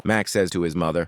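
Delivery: plain reading voice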